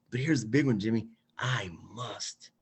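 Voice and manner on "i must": hushed whisper of a vow; whispered affirmation. Shown as a demonstration. Host whispers the key affirmation to Jimmy, hushed. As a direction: whisper it like a secret promise.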